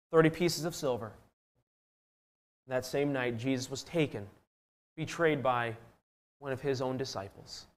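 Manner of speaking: low, soft, with grave pauses; hushed solemn telling. Real, not acted. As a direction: let the story sink quietly, phrase by phrase.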